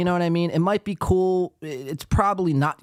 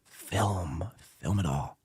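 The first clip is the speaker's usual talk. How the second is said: whisper; 8 semitones lower, 7 dB quieter, much slower than usual. Acted. Urging moles to secretly film.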